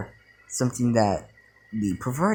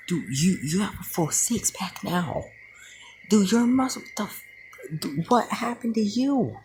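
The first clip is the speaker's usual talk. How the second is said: high-pitched mock astonishment; shocked friend; 9 semitones higher than usual. Two perverted friends stunned at their changed buddy, high-pitched mock shock. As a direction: squeal it in a put-on voice, stunned. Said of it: "Them being shocked".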